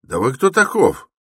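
high, loud challenge; menacing shout. A narrator's reading. Porter challenges the stranger's identity, shouting menacingly. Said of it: "крикнул он погрознее".

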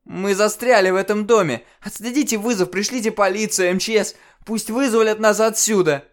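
очень громко и высоко; кричи, захлёбываясь паникой; крик в панике. Acted. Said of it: "В панике кричал я". (паническое требование)

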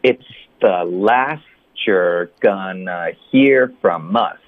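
«It's the last you're gonna hear from us» медленно и слитно; slow linked model sentence. Shown as a demonstration. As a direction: model the whole line slowly, all linked. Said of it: "那整个句子就是".

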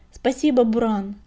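ordinary delivery, nothing marked

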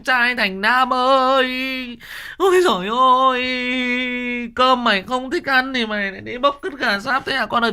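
keen for the son like a grieving mother; mother wailing. Shown as a demonstration. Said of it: "cái đoạn như là mẹ khóc con". (bà mẹ khóc than con, giọng cao và to)

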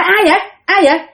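startled questioning. Retold or imitated. Driver's helper hearing a car door open, mildly startled. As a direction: ask as if jolted by a noise. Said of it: "bạn mới giật mình, mà hỏi".

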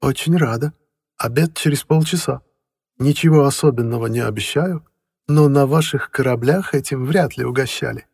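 welcoming, slightly teasing; friendly housekeeper. A narrator's reading. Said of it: "с лёгким акцентом".

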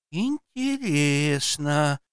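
slow, drawn-out curious Интересно; curious drawl. Acted. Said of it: "протянула с любопытством".

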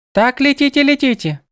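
high, lilting birdlike goddess; sing-song, echoing her own words; bird goddess voice. A narrator's reading. Goddess sends them off.

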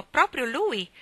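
high-pitched eager confirmation; exclaiming. A narrator's reading. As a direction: pounce on the right answer. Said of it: "esclamò". (esclamazione entusiasta)

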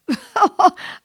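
loud, high burst of laughter; acted-out guffaw at a good joke; hearty laugh. Alvor roars at the captain's mistake, loud high laughter.

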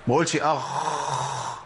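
very slow ما اقولش اخ with the خ dragged too long; خاء ممطوطة زيادة عن زمنها; overlong kha, wrong. Shown as a demonstration. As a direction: stretch the خ in اخ far too long to show the error. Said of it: "ايه يا عم؟ ما ينفعش".

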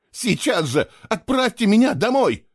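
high, loud furious demand; boy angrily demanding to be sent home; voice breaking with anger. Acted. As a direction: let the anger crack the voice. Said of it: "срывающимся от гнева голосом".

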